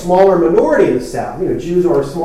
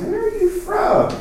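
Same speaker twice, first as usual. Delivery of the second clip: asked where from; 4 dB quieter than usual. Retold or imitated. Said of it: "I often get asked".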